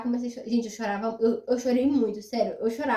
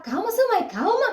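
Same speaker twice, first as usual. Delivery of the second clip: soothing mother-in-law; 9 semitones higher, 4 dB louder than usual. Retold or imitated. Mother-in-law calming her.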